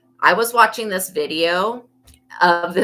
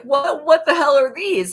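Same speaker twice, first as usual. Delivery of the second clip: mock shocked boyfriend; 11 semitones higher, 6 dB louder than usual. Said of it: "he's like".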